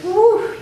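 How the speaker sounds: high loud giddy whoop; lightheaded woo; dizzy woo. Retold or imitated. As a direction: reel from too much air. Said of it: "you may go like".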